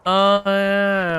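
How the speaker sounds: usual speaking voice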